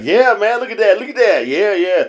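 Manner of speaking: hyped-up quote of a passenger; imitated eager guy. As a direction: play a buddy egging you on. Acts out eager passenger ogling girl.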